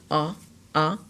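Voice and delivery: soft short "Ah, ah" as in ball; short a sound. Shown as a demonstration. Teacher demonstrating the vowel sound to students, soft. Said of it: "A is acting as a short vowel".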